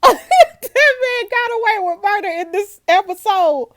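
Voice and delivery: high, loud, laughing outcry; laughing disbelief. Real, not acted. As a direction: burst out laughing, then wail it incredulously.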